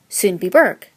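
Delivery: put-on Sundbyberg in a broad American accent; hopelessly American accent. A narrator's reading. Newcomer naming her Swedish suburb, hopelessly American accent. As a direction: say "Sundbyberg" with hard American r's. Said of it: "in my hopelessly American accent".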